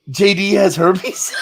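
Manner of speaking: high, laughing retelling of her horror; can barely get it out for laughing; cracking up while quoting her; laughing through the quote. Retold or imitated. Retelling the herpes prank story.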